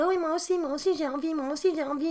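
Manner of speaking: a little sister joining the plea for a snack; eager little girl. Retold or imitated. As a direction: pile on like an eager younger sister. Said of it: "Mathilde fait".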